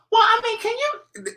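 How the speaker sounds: high squeaky begging voice; mock pestering viewer. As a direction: pitch way up to mock the emailer. Mocking viewers' emails.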